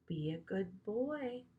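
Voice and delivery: slow, soft coaxing to a beast; quiet tamer's coax. A narrator's reading. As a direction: soothe the lion gently. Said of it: "as she walked toward him slowly".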